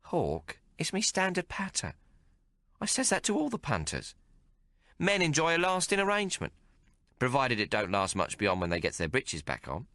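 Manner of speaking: a streetwise barmaid teasing the hero; Maggie's voice. Acted. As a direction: play her worldly and mocking. Maggie explains her patter.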